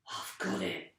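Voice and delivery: plain reading voice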